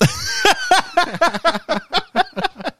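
long falling high laugh; high burst of laughter. Real, not acted. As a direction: cracks up hard and high, then trails down. Reacts to dumb comment.

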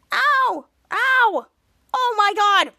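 high, loud mock yelps; acted-out yelps of pain; pained outcry. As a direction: cry out as if being yanked around. Acts out character being yanked.

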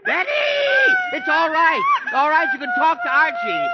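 very high, loud yell over the howling; desperate shout. Acted. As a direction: shout to make it stop. Father shouts over Betty's crying.

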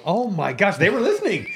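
high, laughing astonishment; amazed parent. Retold or imitated. Parent finding out the grown kids were listening, amazed. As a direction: marvel out loud, pitch leaping up.

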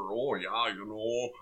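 mild mock Fargo accent. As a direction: lightly imitate a Fargo accent. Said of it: "like, a horrible Fargo accent where".

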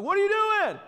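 high, loud mock scold at a kid; scolding yell. Pastor imitating a parent yelling at kids, loud scold. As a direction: snap at the child in exasperation. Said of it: "look at your children all the time like".

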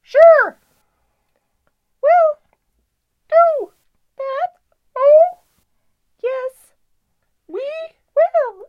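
very high, loud excited squeals; squealing excitement. Acted. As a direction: shriek it out with glee. Acted excited character quote.

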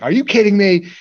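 imitated high-pitched outrage; irate. Allison hearing about the store runaround, irate. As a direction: act out her indignant disbelief. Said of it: "she's irate".

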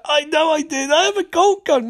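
high-pitched gleeful squeal; retold excitement. Sister thrilled with her gold gun, excited.

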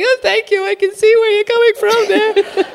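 very high mock-tearful voice; pretending not crying. Crier thanking publisher who rejects her, pretending not to cry. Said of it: "pretending that I'm not crying".

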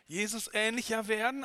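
normal talking voice

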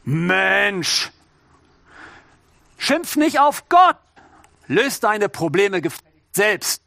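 lightly voiced pride scolding slowly; a hint of haughty pride lecturing the listener. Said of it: "sagt der Stolz".

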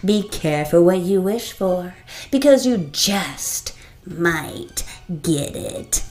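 loud, taunting genie voice. Acted. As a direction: taunt with relish. Genie final warning.

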